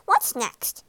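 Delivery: a touch of cheery appetite; cheerful frog. A narrator's reading. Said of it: "said the frog cheerfully".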